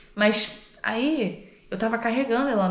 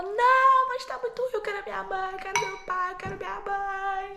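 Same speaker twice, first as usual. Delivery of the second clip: put-on high-pitched childish wail; imitated wailing child; 13 semitones higher than usual. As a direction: cry out for parents like a hurt child. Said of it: "ela só tava gritando".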